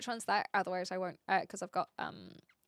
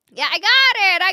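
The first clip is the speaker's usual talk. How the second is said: high, loud cry of success; excited; 10 semitones higher, 15 dB louder than usual. Real, not acted. Streamer finding the clip at last, loud excited cry. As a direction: shout it like you just found the lost thing.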